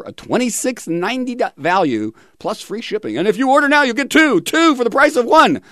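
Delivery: mock "order now" huckster; infomercial pitch. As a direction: parody a late-night ad announcer.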